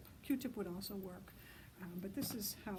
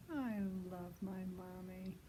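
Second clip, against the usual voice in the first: soft, slow little owl voice; tender owlet; 4 dB quieter, slower than usual. A narrator's reading. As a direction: say it sweetly and slowly, like a tiny owl. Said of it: "Guess what Bill said?".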